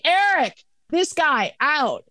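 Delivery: sharp high call across the bar; urgent call to security. Retold or imitated. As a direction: yell for the bouncer to throw him out. Calling the bouncer over.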